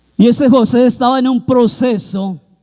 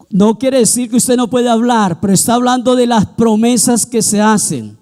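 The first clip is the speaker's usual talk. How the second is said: ordinary talk, nothing marked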